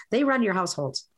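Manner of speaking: usual speaking voice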